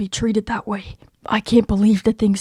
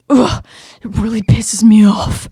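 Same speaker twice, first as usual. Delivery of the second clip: louder, fed-up growl of anger; angry outburst; 7 dB louder than usual. Acted. Shadow furious about how Stone treats Cookie, angry outburst. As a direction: let the anger spill out.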